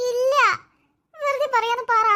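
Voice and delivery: desperate denial. Acted. Sister cries out in disbelief at the news of death, loud and high. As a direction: refuse to accept it, cry out.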